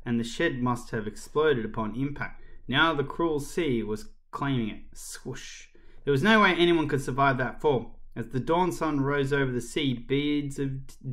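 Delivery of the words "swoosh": soft, whispered swoosh; whooshing. A narrator's reading. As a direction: breathe it like a receding wave. Sea claiming the shed, sound word.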